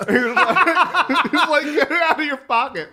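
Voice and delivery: high voice breaking into laughter while quoting; giggling too hard to get the words out; laughing. Real, not acted. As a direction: laugh through the counselor's order.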